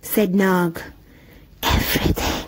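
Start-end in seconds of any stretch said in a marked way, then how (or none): 1.6-2.4 soft hissing whisper. A narrator's reading. Cobra wife whispering to her husband in the dark. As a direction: breathe the word secretly. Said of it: "whispering together".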